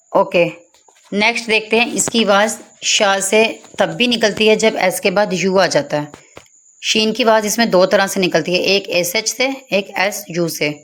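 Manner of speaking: ordinary talk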